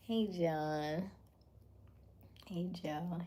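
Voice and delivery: normal talking voice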